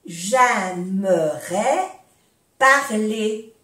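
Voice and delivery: "J'aimerais parler" slowly, split into two parts; slow breakdown. Shown as a demonstration. Teacher modelling for learners, "J'aimerais parler" slowly in pieces. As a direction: stretch "J'aimerais", pause, then "parler".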